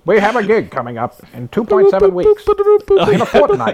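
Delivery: announcer voice. Acted. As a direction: call the band together like a herald. Mock band announcement.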